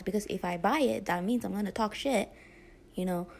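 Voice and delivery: ordinary talk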